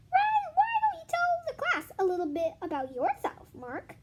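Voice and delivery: high, sweet teacher voice. Acted. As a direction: put on a high, syrupy teacher tone. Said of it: "suggested Missus Jules".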